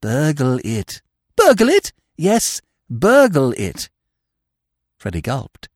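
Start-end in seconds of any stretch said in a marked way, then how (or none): 1.4-1.9 very high, loud incredulous question; shocked echo. A narrator's reading. Freddy echoes Eve's plan, shocked echo. 2.2-3.9 slow, higher "burgle it" with a falling end; firm insistence. A narrator's reading. Eve confirms the plan to Freddy, firm insistence on "burgle it".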